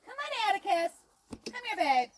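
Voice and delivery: normal talking voice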